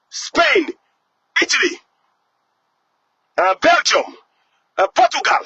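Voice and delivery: ordinary talk, nothing marked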